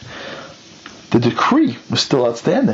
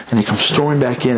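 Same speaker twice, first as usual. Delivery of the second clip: plain reading voice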